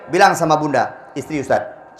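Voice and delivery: ordinary talk, nothing marked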